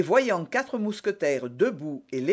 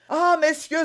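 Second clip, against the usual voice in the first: high, loud cry for help; panicked cry; 12 semitones higher, 7 dB louder than usual. A narrator's reading. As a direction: burst in crying out in terror. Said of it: "s'écria-t-il". (cri de panique, aigu)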